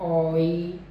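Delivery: ordinary talk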